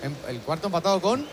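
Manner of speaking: talking with a pen between the teeth; pen in mouth. Real, not acted. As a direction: speak around a pen in your mouth. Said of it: "Sácate el boli de la boca".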